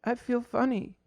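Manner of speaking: put-on a child slipping away; faint kid voice. Dying kid, fading.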